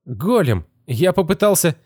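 put-on high, weak voice of a dazed boy; groggy young boy, just woken; boy's voice. A narrator's reading.

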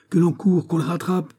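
high-pitched, urgent order; urgent exclamation. A narrator's reading. Master ordering servants after the fugitive, crying out urgently. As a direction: cry out for an immediate chase. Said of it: "s'écria Fouquet".

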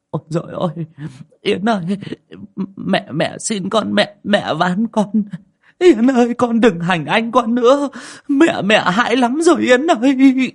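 begging the dead daughter, rising to a wail; tearful prayer. Acted. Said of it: "chắp tay run rẩy mà khấn".

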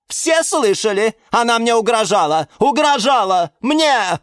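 high, loud accusing cry; high-pitched scandalised shouting; explosive outrage. A narrator's reading. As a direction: explode and appeal to everyone. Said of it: "взорвалась Галатея".

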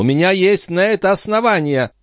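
high, cross retort; peevish countess. A narrator's reading.

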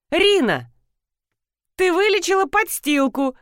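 high, merry narrated tease; amused exclamation. A narrator's reading. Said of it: "развеселился Димон". (весёлое восклицание)

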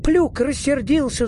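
high-pitched alarmed cry; mild acted shout of a thin little man. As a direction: call out a warning, pitched well up. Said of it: "закричал худой человечек".

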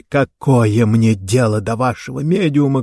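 usual speaking voice

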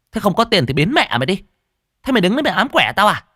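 high, rapid-fire scolding; irritated snapping. A narrator's reading. Stall owner chasing away a penniless beggar, irritated. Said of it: "Bà chủ quán cáu".

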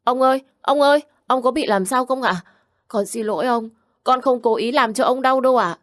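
put-on high, loud crying child voice, pleading; a frightened child bursting into tears; sobbing child. Little Vũ sobs apologies to his hurting grandpa, high and loud. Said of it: "Vũ lúc này cũng òa lên nức nở".

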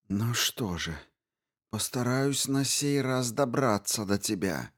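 soft menacing vow; simmering rage under the breath. A narrator's reading. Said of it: "с чёрной яростью".

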